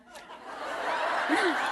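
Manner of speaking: silent breathy laughter; wheezy embarrassed laugh; breathless laugh. Real, not acted. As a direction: laugh helplessly under your breath. Laughing at the question.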